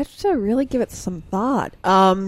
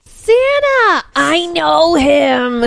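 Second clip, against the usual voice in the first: high, loud excited cry; excited shout; 5 semitones higher, 5 dB louder, much slower than usual. Pretend. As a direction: squeal the name, then draw it out.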